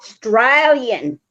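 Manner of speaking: slow, drawn out "Australian" with a long ay: strey-lee-en; corrected Australian. Shown as a demonstration. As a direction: model "Australian" slowly, stressing the long ay. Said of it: "No, Australian".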